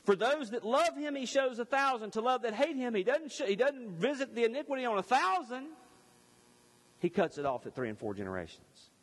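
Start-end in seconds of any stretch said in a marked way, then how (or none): 0.0-5.7 raised, ringing preacher's voice lifting high; fervent preaching. Acted. Preacher urging his congregation, ringing and raised.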